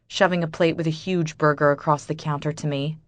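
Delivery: plain reading voice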